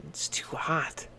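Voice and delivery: slow hushed whisper; girl whispering about hot oatmeal. A narrator's reading. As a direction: breathe it out, too hot to sip. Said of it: "she said".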